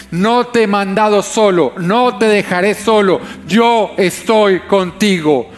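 preaching at full force. Acted. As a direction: proclaim it to the whole room. Preaching voice of God's promise.

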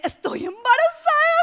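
high shocked exclamation; shocked cry. Retold or imitated. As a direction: blurt it in alarm.

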